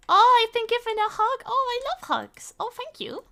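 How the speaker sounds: very high, gushing thanks; delighted squeal. Real, not acted. Reacts to a hug from chat.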